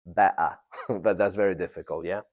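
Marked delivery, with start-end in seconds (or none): 0.0-0.6 better with the t swallowed, a glottal stop, be'uh; casual British better, glottal t; informal British. Shown as a demonstration. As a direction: say better like casual London speech, t dropped. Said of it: "Informal British pronunciation".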